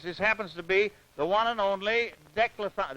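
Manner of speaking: ordinary talk, nothing marked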